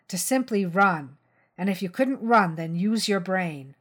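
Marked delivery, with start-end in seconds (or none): none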